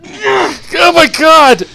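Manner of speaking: slow, loud, high stretched cry; drawn-out shocked shout. Real, not acted. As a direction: let the horror burst out slowly. Shocked reaction to violent scene.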